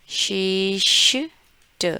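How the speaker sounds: शिष्ट stretched out very slowly, shisht; slow syllable demo. Shown as a demonstration. Teacher breaking Geschichte into pieces for students. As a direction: drag out शिष्ट slowly and clearly. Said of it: "कैसे बोलेंगे?".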